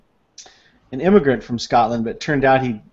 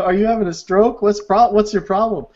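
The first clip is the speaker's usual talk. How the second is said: higher-pitched mock confrontation; acted incredulous challenge; 7 semitones higher than usual. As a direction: challenge him in exaggerated bafflement. Acts the baffled man confronting friend.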